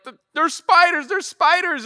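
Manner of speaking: put-on high, frightened cry of a scared boy; panicked kid. Son on a floating board, panicking about spiders, high and frightened. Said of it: "he started to freak out a little bit".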